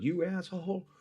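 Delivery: high mock-angry creature voice; angry bug. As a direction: squeak it out as an offended little bug. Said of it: "Medium-sized bug goes".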